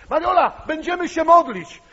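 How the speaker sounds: high, loud call to prayer; loud exclamation. Retold or imitated. To his sister in crisis, loud insistent call. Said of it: "i powiedziałem".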